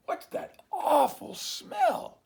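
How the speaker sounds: high, slow, loud squeal of disgust; dog's outraged cry. Acted. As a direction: bellow your disgust at the stink. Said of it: "he growled".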